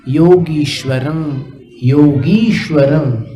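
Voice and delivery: योगीश्वरम with a long "ee", not "e"; long ee sound. Shown as a demonstration. Teacher correcting students' योगेश्वरम to योगीश्वरम. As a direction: stretch the ई in योगीश्वरम. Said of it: "योगेश्वरम नहीं".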